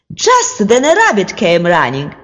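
plain reading voice

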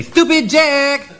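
drawn-out high yell of the name; long yell. Acted. Man with a pig yelling at Jack, drawn-out high call. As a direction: stretch the name out as if yelling it into his head.

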